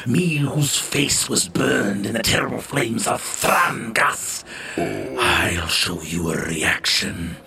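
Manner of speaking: evil queen. Acted. Villain chef's menacing reply.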